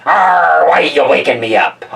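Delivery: piratical growl of annoyance; mock-cross "arr", then complaint; ticked-off mouse. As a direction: grumble like a grumpy woken pirate. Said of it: "Mouse looks all ticked off too".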